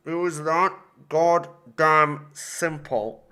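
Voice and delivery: slow, word-by-word exasperation; slow, seething disbelief; bitter emphasis. Real, not acted. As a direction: spell it out slowly, GODDAMN set apart.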